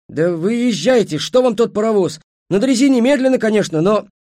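high, loud, anxious self-justification; nervous pleading. A narrator's reading. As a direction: plead as the powerless underling. Frightened driver pleads his case. (взволнованно оправдываясь, высоко и громко)

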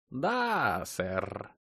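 robotic computer with rolled R. A narrator's reading. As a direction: stretch it like a machine acknowledging orders. Computer replies in the story.

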